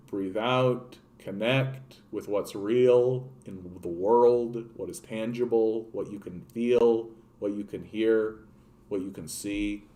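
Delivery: a lightly voiced calm-down guide; mock guided meditation. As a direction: lead the listener into breathing, gently. Guiding listeners to breathe.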